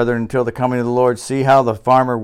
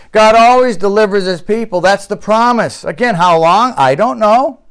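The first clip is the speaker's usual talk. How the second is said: higher, louder preaching at full force; fiery preaching; 7 semitones higher, 5 dB louder than usual. Acted. Pastor warning his congregation, fiery full-force preaching.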